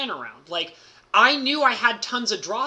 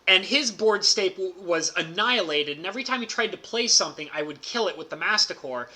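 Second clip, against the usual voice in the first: usual speaking voice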